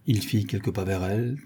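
ordinary delivery

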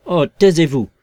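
loud, high, forceful outburst; sudden shout. A narrator's reading. Invisible man cutting off Marvel's complaint, sudden shout. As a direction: silence him with a burst of force. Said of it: "cria la voix avec une force soudaine et surprenante".

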